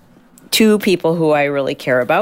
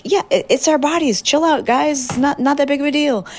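much higher, breezy shrugging voice; imitating Doctor Kelly; 10 semitones higher than usual. Host imitating Doctor Kelly telling listeners to chill, breezy and high. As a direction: toss it off like no big deal, pitched way up. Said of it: "And she's like".

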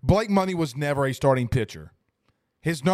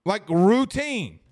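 "routine" drawn out slow and high; stretched routine; 4 semitones higher, much slower than usual. Acted. Streamer mocking a botched routine grounder, slow stretched "routine".

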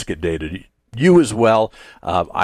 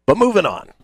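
upbeat; 9 semitones higher, 3 dB louder than usual. Acted. Mock-dramatic segment change.

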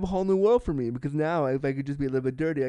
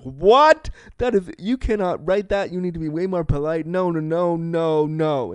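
loud, high, flustered protest; high-pitched mortified outcry; shocked protest; 3 semitones higher, 6 dB louder than usual. Retold or imitated. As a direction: blurt out scandalised objections. Horrified at his dirty message.

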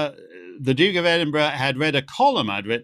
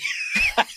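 high shouted laugh at himself; burst of laughter. Real, not acted. Laughing at own blunder.

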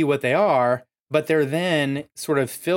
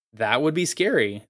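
usual speaking voice

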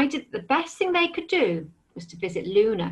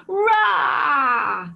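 loud, higher roaring shout; fearsome dragon roar; 5 semitones higher, 4 dB louder than usual. Acted. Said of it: "With a fearsome roar".

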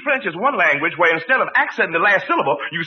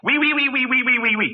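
a burst of mock French, pitched higher; 4 semitones higher than usual. Shown as a demonstration. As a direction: rattle off the ouis like a stage Frenchman. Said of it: "It's real French".

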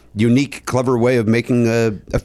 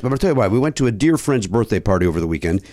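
ordinary talk, nothing marked

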